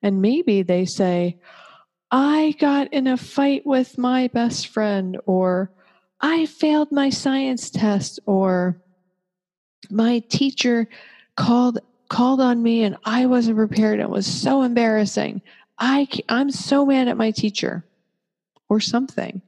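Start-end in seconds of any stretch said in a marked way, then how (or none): 2.1-5.2 put-on upset teen. 6.2-8.2 put-on upset teen. 9.9-17.8 put-on upset teen.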